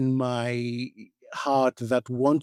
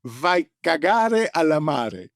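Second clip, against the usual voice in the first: put-on Italian accent; 4 semitones higher, 4 dB louder, slower than usual. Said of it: "the Italian said".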